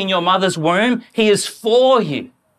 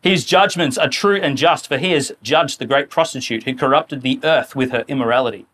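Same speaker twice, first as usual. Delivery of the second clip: scripture reading; 6 semitones lower than usual. Acted. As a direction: read the verse solemnly.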